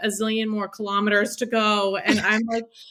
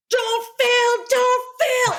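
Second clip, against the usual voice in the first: desperate pleading; 13 semitones higher, 4 dB louder, much slower than usual. Retold or imitated. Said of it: "literally going".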